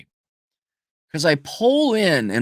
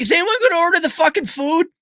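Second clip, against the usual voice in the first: exasperated outburst; faster than usual. Retold or imitated. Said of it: "I'm like".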